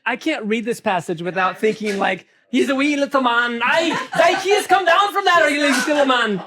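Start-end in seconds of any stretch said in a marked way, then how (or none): 2.5-6.4 higher, jokey Irish lilt, slowing on Zacchaeus; hammed-up Irish calling him down from the tree; Irish accent. Teacher playfully calls Zacchaeus down in an Irish accent. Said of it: "an Irish accent comes out of my mouth".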